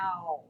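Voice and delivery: Ow drawn out as the O W sound; vowel sound demo. Shown as a demonstration. Teacher isolating the vowel sound, Ow. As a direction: sound out Ow for the class. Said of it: "It's got the O W sound".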